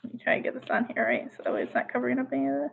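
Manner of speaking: very soft, rapid muttering; hushed, hurried aside while fiddling with a screen; muttering to self. Real, not acted. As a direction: mumble quickly under your breath.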